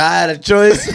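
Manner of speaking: high, loud teasing imitation; mock his excuse while laughing; mocking quote through laughter. Friend mocks the teller's excuse.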